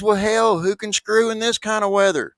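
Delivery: high, loud outraged punchline; hammed-up indignant shout; exasperated shout. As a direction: fling it out as you flee. Punchline man fleeing.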